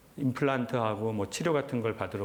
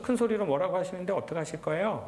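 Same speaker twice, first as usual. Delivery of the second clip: higher-pitched, helpless caller; flustered clinic coordinator; 7 semitones higher than usual. Retold or imitated. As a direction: plead helplessly as the flustered receptionist. Flustered coordinator voiced in retelling.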